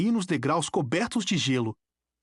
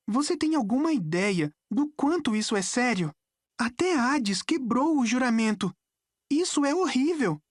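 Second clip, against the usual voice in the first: put-on high, urgent girl's voice; earnest dismay, raised pitch; alarmed; 8 semitones higher than usual. A narrator's reading. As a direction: press the seriousness, pitch climbing toward the end. Annabeth alarmed at the grave news.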